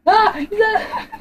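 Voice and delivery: high scared giggle; laughing shriek. Real, not acted. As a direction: squeal it through laughter. Sees monster again.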